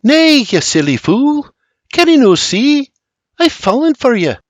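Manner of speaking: high, pleading Scottish lilt; sweet desperation. A narrator's reading. Mary confesses love to William, sweetly desperate Scots. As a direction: confess it with tender, mild desperation. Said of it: "With sweet desperation, she breathes".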